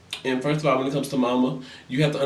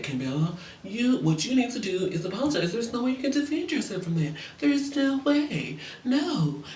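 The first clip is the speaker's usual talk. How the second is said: higher, put-on scolding voice with repeated no; mock preachy friend; 5 semitones higher, 4 dB quieter than usual. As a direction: ham up a self-righteous lecture.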